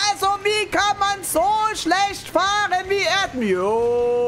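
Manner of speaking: high, slow exasperated wail; exasperated complaint. Real, not acted. Streamer moaning about terrible driving, exasperated wail.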